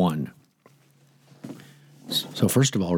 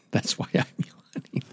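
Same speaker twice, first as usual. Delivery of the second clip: laughing whisper; 6 semitones higher, 5 dB quieter than usual. Real, not acted. Tired after babysitting grandson.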